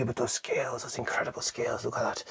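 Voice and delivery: soft, hushed wonder; awed whisper. Real, not acted. Reviewer admires the sculpted scales in a whisper. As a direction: breathe the words out in awe.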